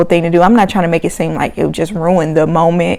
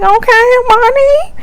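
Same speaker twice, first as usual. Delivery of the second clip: impressed quote. Retold or imitated. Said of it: "I'm like".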